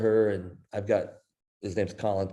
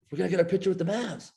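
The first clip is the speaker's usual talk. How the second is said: much higher and fast, gushing; excited daughter; 10 semitones higher, faster than usual. Retold or imitated. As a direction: rattle it off high like a thrilled girl. Daughter gushing about the prize.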